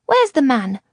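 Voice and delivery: put-on a small girl pressing for an answer; insistent little girl. A narrator's reading. Girl repeats her question.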